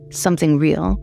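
plain reading voice

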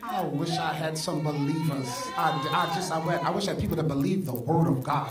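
preaching call. Real, not acted. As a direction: press the crowd for a response. Preacher urging the congregation.

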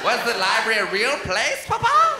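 mock child's wondering questions, rising high at the end; loud, incredulous kid impression; curious great-grandchild. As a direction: act an amazed kid quizzing papa. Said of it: "running up to their great-grandfather like".

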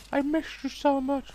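very high sing-song pun; mock sweet. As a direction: coo it like a lovesick reunion. Pun on missing shot.

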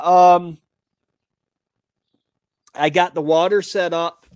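normal talking voice

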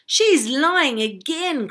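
loud, high, drawn-out protest; indignant cry. A narrator's reading. Tom protesting at Arabella's fibs, crying out. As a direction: cry out in exasperation. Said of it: "cried Prince Tom".

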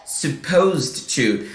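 slow "supposed to", two syllables in "supposed"; slow model of "supposed to" as suh-POHZD-to; correct -ed ending. Shown as a demonstration. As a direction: run the end of "supposed" into "to", no extra vowel. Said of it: "A gente fala".

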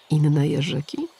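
ordinary delivery, nothing marked

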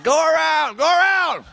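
high loud shouted order from a cop; cop yelling. Retold or imitated. Comic telling a crowd about a cop, shouting. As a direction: bark it like a cop waving you past.

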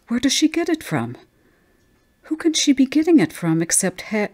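high, pressing questions, breaking off; high-pitched anxious demand; agitated questioning. Acted. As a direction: build in alarm, then cut short.

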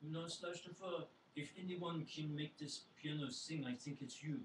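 put-on mom's mixed French, Southern and Yiddish accent; slow, coaxing French-Yiddish-Southern lilt. Mom encouraging her son at the piano, French-Southern-Yiddish accent. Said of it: "my mom has a, a southern accent, but she's from France".

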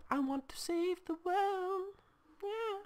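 very high, drawn-out put-on voice; silly, stretched falsetto; mock-heroic squeal. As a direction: squeak it out slowly like a tiny would-be hero. Mock-heroic wish to save the world.

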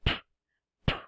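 P as a short puff of air with no vowel; teacher showing the sound of p; letter sound. Shown as a demonstration. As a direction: pop P twice with no vowel. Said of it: "¿Y cómo suena la consonante P?".

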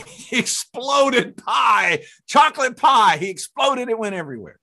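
high, loud storyteller's outburst; laughing burst of excited storytelling; comic climax. Real, not acted. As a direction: blurt it out in high-pitched amazement.

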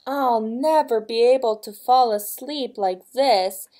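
loud, mildly irritated storybook queen; snapping old queen. A narrator's reading. Old queen with sand in her sheets, mildly snapping. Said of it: "snapped an old queen".

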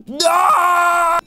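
high, loud cry of alarm; panicked shout. Real, not acted. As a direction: yell no in panic.

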